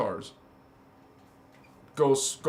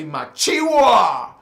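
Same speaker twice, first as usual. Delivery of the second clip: shouted slogan; 3 semitones higher, 8 dB louder, slower than usual. Shown as a demonstration. Announcing what the t-shirts say, shouted slogan. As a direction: belt it out like a battle cry.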